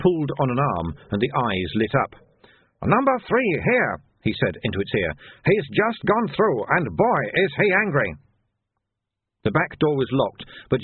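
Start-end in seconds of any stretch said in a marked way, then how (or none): 2.8-3.9 high, hurried report into a speaker; lightly voiced shopkeeper. Soon speaks into dummy. 5.5-8.1 lightly voiced shopkeeper. Soon warns accomplice.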